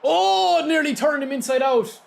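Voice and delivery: high, loud burst of delight; excited shout. Real, not acted. Streamer thrilled by a dribble in his game, excited shout. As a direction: yelp with excitement at the move.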